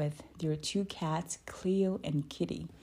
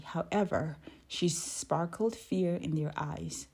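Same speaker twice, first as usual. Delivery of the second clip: plain reading voice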